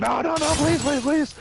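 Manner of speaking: very high, frantic mock pleading; mock begging. Thief begging with a rifle at his head, hammed-up. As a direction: beg for your life, hammed-up. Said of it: "He's like".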